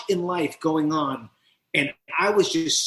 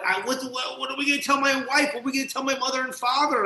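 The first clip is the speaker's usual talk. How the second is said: high, fast panicked questions; panicked worry; 9 semitones higher, faster than usual. Retold or imitated. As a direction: spill the worries out in a rush. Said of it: "I'm like".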